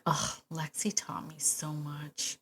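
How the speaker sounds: slow, breathy whisper of fond gratitude. Retold or imitated. Host fondly recalling her dog Lexi, whispered. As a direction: breathe it out softly, grateful. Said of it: "now I say it almost as a".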